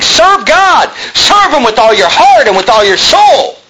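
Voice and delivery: preacher thundering at the congregation to serve God; full-force preaching. Acted. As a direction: call it out to the back row.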